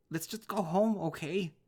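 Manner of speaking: high, loud begging; tearful cry to dad; desperate pleading. Acted. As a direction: plead to just go home.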